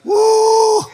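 high, loud joyful whoop shouted at the congregation; joyful woo. Real, not acted. Preacher whoops to the church, loud joyful cry. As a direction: let out a delighted woo. Preacher exults after scripture.

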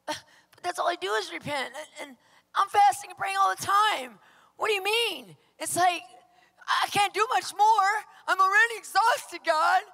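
high, loud, pleading complaint; exasperated protest. Retold or imitated. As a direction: wail it like you're worn out. Arguing back at the voice.

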